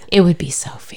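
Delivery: hushed whisper. Real, not acted. S0 to S1 about Sophie, whispered. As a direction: whisper it like a secret.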